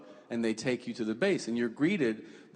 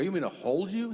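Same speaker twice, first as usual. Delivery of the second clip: higher-pitched questioning dad; mildly incredulous question, pitched up; retold surprise; 5 semitones higher, faster than usual. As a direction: act out a dad taken aback. Father surprised by son's request.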